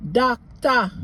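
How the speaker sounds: "doctor" with the OR ending as a clear A, docta; Jamaican patois doctor. Shown as a demonstration. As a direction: end "doctor" on A, no R. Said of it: "replace that O R with an A".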